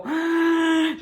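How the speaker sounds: long, high gasp for breath; drawn-out theatrical inhale; exaggerated gasp. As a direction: suck in a big audible breath mid-count. Teacher counting out syllables.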